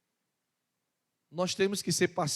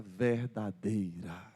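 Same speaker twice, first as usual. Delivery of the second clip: drawn out; 10 semitones lower, much slower than usual. Real, not acted. Preacher slowing on key word.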